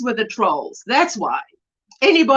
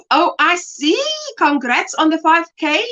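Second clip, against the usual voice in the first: ordinary talk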